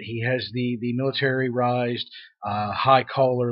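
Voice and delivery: normal talking voice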